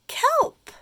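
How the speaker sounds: high, loud call of a name. Acted. Creature calling to Kelp from the cliff, high and loud.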